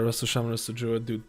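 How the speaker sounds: ordinary talk, nothing marked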